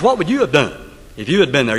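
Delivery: ordinary talk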